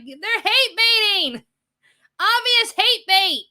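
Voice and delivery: very high, slow, loud accusation; exasperated yell. Acted. Streamer warning viewers about obvious bait, exasperated yell. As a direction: yell each word out, stretched and high.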